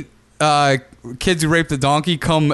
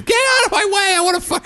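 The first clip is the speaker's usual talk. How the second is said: much higher mock big-shot voice; mock Ken Kratz; faster than usual. Mocking Kratz as an entitled creep, high mock voice. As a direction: ham up a swaggering creep. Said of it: "I can see him".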